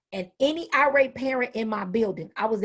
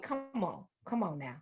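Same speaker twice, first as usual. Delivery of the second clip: soft weary come on; 6 semitones lower, 12 dB quieter than usual. Retold or imitated. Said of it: "you like".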